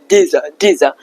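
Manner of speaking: dizza, the D and Y of did you fused into a Z; dizza sound. Shown as a demonstration. Teacher demonstrating the linked did-you sound. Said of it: "the second thing, which is very important".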